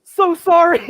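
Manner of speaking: high, loud, flustered apology; retold frantic apology. Host apologizes for the wrong time, high and flustered.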